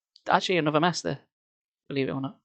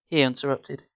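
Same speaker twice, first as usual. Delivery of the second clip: plain reading voice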